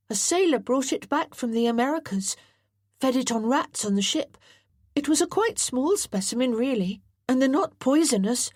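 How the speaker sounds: put-on an excited boy telling his hobby; eager boy John. John explaining the snake.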